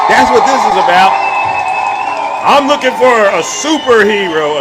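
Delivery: loud, high, heated rant; fired-up livestream rant; ranting shout. Real, not acted. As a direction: blast it at the audience. Ranting at viewers on live stream.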